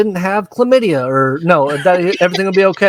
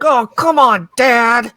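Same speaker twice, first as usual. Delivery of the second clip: put-on whiny teen son; 7 semitones higher, slower than usual. Son whining to his father.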